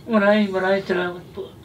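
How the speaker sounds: tearful, voice high and breaking; choked-up thanks through tears; crying. Real, not acted. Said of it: "Naiiyak kayo". (umiiyak habang nagpapasalamat)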